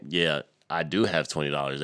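ordinary talk

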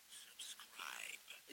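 breathy whisper of one word. Shown as a demonstration. Podcast host whispers a plug to her listeners. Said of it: "You heard it?".

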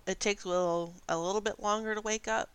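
ordinary talk, nothing marked

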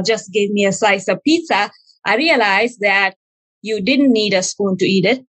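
normal talking voice

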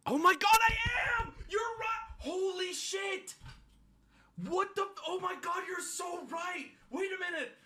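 very high, hushed mock shouting; astonished realization. Comic told he's the opposite of his story, astonished. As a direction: squeal softly as if having an epiphany. Struck by friend's remark.

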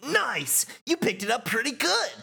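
high, excited praise; high-pitched hype; Shooter. Acted. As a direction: cheer it in a high character voice. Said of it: "Shooter says".

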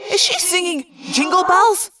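high-pitched incredulous question; demanding. A narrator's reading. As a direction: demand an answer, baffled. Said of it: "Marco demanded".

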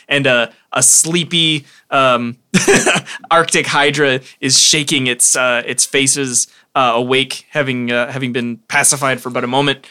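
normal talking voice

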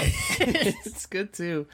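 high, drawn-out words through laughter; laughing. Real, not acted. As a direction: let the laugh stretch the words out. Cracking up with the co-host.

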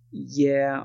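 usual speaking voice

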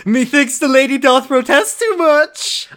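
high, loud, slow theatrical recital; mock Shakespearean declamation. [name] quoting Billy the Bard, hammed-up declamation. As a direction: declaim it like a hammy stage actor.

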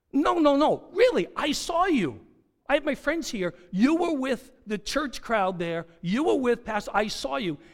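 high, loud acted protest; emphatic, start loud and ease off slightly; insistent accuser. Acting out a second accuser.

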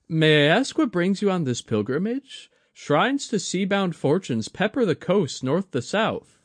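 put-on young woman's voice, worried and kind; Mai, young woman. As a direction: play Mai fussing over an elder. Mai speaks.

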